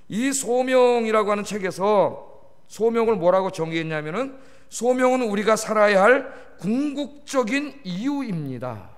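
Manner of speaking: usual speaking voice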